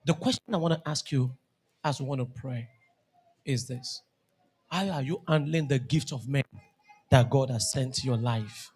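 grave, hushed altar-call preaching; solemn preaching. Acted. Slow solemn close, call to pray.

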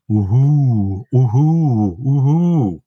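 hooting owl call, rising with each hoot; amateur owl hoot impression; imitated eagle owl call. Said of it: "this call that goes".

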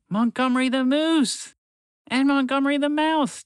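high, excited naming of friends; excited announcing. Acted. Tamar spotting her friends arriving, high excited call. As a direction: announce them with delight.

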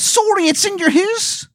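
very high, exaggerated bad Canadian accent; Angel of Canada, Canadian accent. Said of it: "it is a horrible Canadian accent".

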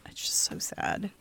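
whispered sorrow; sad whisper. Real, not acted. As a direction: hush it out sadly. Sad story of the camps.

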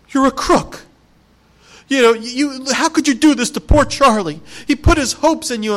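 mock Wonka slamming the door on them; stern dismissal. Grandpa accuses Wonka.

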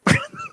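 a very high quick laugh. Real, not acted. Host laughing after predicting the Oscar win. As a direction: squeak out a laugh.

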